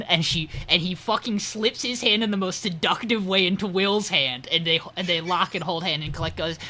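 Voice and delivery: ordinary talk, nothing marked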